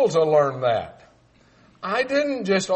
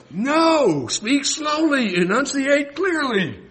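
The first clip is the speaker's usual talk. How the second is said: high, loud, over-careful mock instruction; slow and emphatic, every syllable separated; slow, clear enunciation; 8 semitones higher, 3 dB louder, slower than usual. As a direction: imitate locals scolding a poor speaker, word by word. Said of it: "those people would say".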